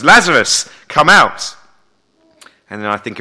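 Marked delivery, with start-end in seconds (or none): 0.0-1.5 ringing call, much higher and louder; loud command. Acted. Jesus speaks out at the silent grave, loud ringing command. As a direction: summon the dead man out, full voice.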